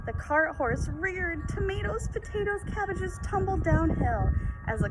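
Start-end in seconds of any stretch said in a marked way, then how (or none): none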